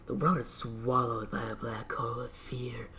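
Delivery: hushed, dread-filled narration; whispered narration. Acted. Inner voice describes the detective's fear in a whisper. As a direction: whisper it like a dark omen.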